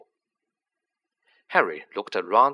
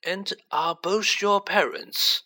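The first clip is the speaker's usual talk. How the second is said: put-on old headmaster voice; 8 semitones higher, slower than usual. A narrator's reading.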